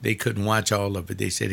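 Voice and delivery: normal talking voice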